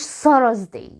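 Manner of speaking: Thursday as 'Sarazday'; wrong Thursday. Shown as a demonstration. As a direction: mispronounce Thursday with an S and an extra vowel. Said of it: "ما اسمهوش".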